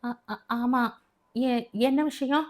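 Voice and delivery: a mother stumbling over words before the police; frightened stammer. A narrator's reading. Police at the door, mother stammers in fright. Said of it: "அவள் மிரண்டு".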